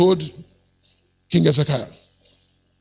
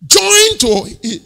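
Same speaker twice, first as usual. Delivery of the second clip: 1 emphatic echo; 11 semitones higher, 4 dB louder, much slower than usual. Acted. Preacher echoes his shout.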